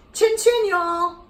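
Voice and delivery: high, loud toast; proud toast. Retold or imitated. Toasting the high school friends, high and loud. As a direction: raise the glass grandly. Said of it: "I said".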